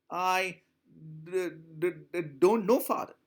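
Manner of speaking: a lightly acted stutter on the first word. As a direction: stammer out the words as a scared child. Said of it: "She was stuttering".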